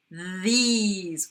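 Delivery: "These" with a loud voiced th; buzzing th. Shown as a demonstration. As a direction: buzz into "These". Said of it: "that's a buzzer".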